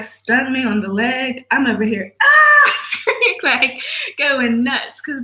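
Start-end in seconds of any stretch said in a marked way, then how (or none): 2.2-2.7 sudden high loud yelp of pain. Retold or imitated. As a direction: yelp like the sting just hit. Stung by a wasp, yelping.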